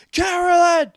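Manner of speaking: very high, full-force shouting; loud, high re-enacted cries; desperate movie yell. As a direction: call out to someone possessed, as in an exorcism scene. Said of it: "is like".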